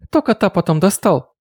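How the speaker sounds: put-on high twittering girl. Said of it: "прощебетала Люсиль".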